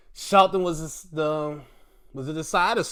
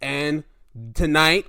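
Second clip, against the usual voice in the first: normal talking voice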